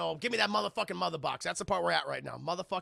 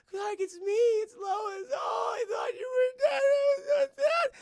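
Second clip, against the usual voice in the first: much higher, loud wailing cry; hammed-up sobbing heroine; 7 dB louder, slower than usual. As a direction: wail it like a melodramatic heroine. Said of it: "And she goes".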